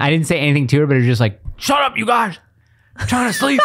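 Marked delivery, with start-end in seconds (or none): 1.6-3.6 high, strained mock yell; really mad, retold. Retelling his half-asleep outburst at the family, mad mock yell. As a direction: play a cranky sleeper losing it. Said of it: "You got really mad".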